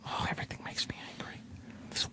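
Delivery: very soft, quick angry whisper; barely audible seething aside; whispered grumbling. Acted. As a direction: grumble it under the breath. Grumbling to himself about being angry.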